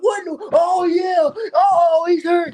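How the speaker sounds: high, loud, slow hyped shout; fans hyped. Pretend. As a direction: shout it like a stunned fan. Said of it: "Y'all gonna be like".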